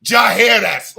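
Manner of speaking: "Jaehaerys" shouted loud in disbelief; shouted name. Shown as a demonstration. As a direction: bellow "Jaehaerys" like it's ridiculous.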